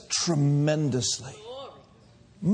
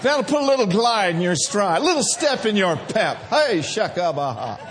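full-force preaching; 3 semitones higher than usual. Acted. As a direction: whip the congregation up. Exhorting congregation, shouting praise.

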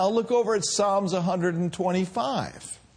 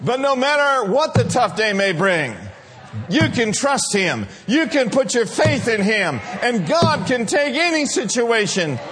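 loud, rising preacher cry; full-force preaching; 2 semitones higher, 3 dB louder than usual. Acted. Pastor rallying the congregation, loud fervent preaching. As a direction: lift the church with it.

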